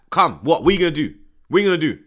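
loud mock taunt of a cocky player. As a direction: throw a lightly voiced cocky challenge. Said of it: "standing there and saying, like".

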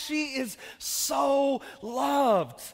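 very slow, drawn-out and emphatic; preached declaration. Real, not acted. Pastor declaring love for his daughter, very slow and emphatic. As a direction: stretch out so loved.

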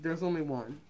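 muffled by cloth mask; through a face mask. Real, not acted. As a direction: mouth covered while speaking.

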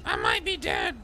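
harsh, strained creature voice; Hobbit. Pretend. Patient telling the medic of possible death, harsh strained voice. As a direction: shout it through a wrecked throat. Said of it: "it wrecks your fucking throat, right".

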